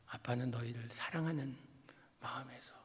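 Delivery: whispered, almost breathless; hushed whisper. Real, not acted. Preacher confiding to the congregation in a whisper. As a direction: lean in and breathe the words.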